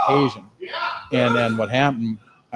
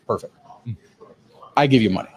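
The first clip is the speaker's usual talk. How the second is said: imitating wife; 3 dB louder than usual. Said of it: "I love the way he leaves all the, the vowels out".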